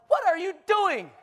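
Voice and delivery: high, startled patient's cry, laughing through it; startled yelp. Acted. Patient startled as the nurse lifts the sheet, startled high cry. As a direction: jump out of your skin as a patient.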